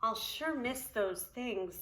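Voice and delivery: wistful. Acted. Poppleton leaving his things.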